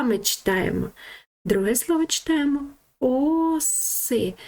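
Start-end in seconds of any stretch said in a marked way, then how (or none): 3.0-4.3 "оси" with a long stressed first О. Shown as a demonstration. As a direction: stretch the opening vowel of "оси". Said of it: "Наголос буде на букву О". (протяжний наголос на О у слові «оси»)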